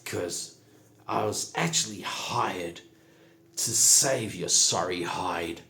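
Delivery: tough goblin holding a knife to his throat; measured menace, punching the commands; Saza's stern threat. Acted. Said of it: "puts a knife to his throat and says".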